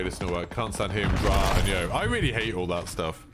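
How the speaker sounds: ordinary talk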